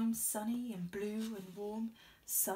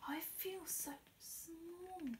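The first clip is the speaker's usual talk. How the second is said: higher, soft, slow awed whisper; awed small voice; 7 semitones higher, 8 dB quieter, slower than usual. A narrator's reading. Snail awed by the world, small high whisper. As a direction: marvel at how small you are. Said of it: "She said to the whale".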